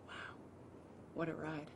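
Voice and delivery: very soft, wistful murmur; hushed reflective awe. Retold or imitated. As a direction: look back in quiet wonder. Reenacting wistful look back years later.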